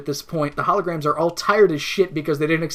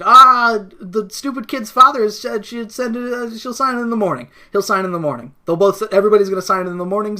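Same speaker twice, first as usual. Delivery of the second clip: higher, hurried, overly reassuring; mock eager lying; 4 semitones higher, 6 dB louder than usual. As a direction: cover the lie with cheerful fuss. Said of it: "it's just like".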